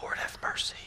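hushed, whispered amazement; awed whisper. Retold or imitated. Man turning to see his straight cut line, awed whisper. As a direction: whisper it to yourself as if stunned.

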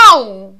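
loud sharp ouch cry; yelp of pain. Acted. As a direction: cry out at the splinter. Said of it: "țipă Habar n-am".

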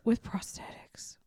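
slow hushed whisper; mock-secret whisper. Real, not acted. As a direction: whisper the words back slowly. Whispered repeat.

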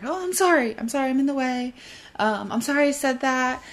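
high, meek apology voice; over-apologizing. Retold or imitated. A people-pleaser apologizing just for being in the way. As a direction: sound small and sorry for existing.

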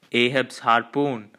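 plain reading voice

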